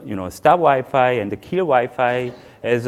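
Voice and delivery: normal talking voice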